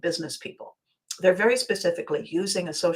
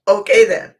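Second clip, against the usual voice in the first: words squeezed out through real laughter, loud; laughing; 2 semitones higher, 11 dB louder than usual. Real, not acted. Reacting to the clerk's birth year, laughing as she talks. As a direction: say it while still cracking up.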